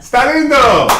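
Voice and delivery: high, loud excited cry; excited shout. Acted. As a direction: burst out with a happy shout. Sudden excited Spanish exclamation.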